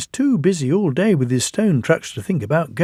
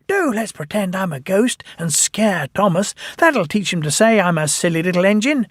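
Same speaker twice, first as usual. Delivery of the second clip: mischievous Percy; 6 semitones higher than usual. A narrator's reading. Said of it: "Percy chuckled".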